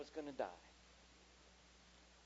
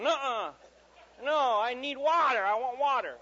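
high, loud drawn-out demand; hammed-up child protest; whining child; 10 semitones higher, 16 dB louder than usual. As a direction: insist loudly like a kid at bedtime.

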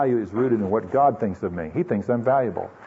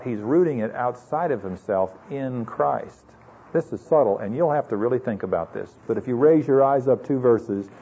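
ordinary talk, nothing marked

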